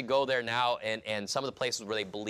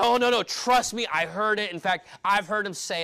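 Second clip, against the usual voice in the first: high, loud eager protest; excited insisting; 9 semitones higher, 7 dB louder than usual. Retold or imitated. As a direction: convince them it's really him. Rhoda insisting in the argument.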